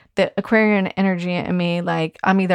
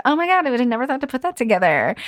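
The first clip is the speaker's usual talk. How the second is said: higher, fast, gushing client voice; 6 semitones higher, faster than usual. Retold or imitated. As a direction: play the amazed client raving about the outfit. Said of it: "It's like".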